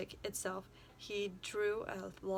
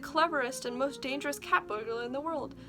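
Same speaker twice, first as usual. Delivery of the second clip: police chief; 6 semitones higher, 6 dB louder than usual. A narrator's reading. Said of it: "said the chief of police".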